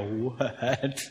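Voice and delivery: drawn-out, soft what; long, rising, incredulous question; acted amazement. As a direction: stretch it out in mock disbelief. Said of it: "I read this story and I go".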